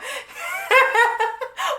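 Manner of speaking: high, sustained cackling laugh. Real, not acted. Woman cracking up at her own texting typo, high laughter.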